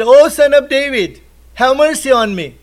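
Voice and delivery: high, loud cry for mercy; retold shouting plea. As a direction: call out like the blind man begging to be heard. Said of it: "So he starts shouting more".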